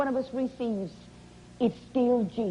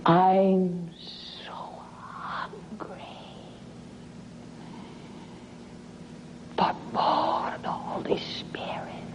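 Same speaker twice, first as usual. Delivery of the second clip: slow, breath-only whisper after the first word; timid whisper; 5 dB quieter, slower than usual. Retold or imitated. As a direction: imitate a shy plea, whispered with long pauses. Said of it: "very timidly she whispered".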